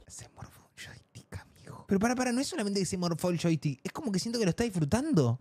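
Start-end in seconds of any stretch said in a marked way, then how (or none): 0.1-1.8 shocked whisper; whispered aside in shock. Real, not acted. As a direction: breathe it out in disbelief.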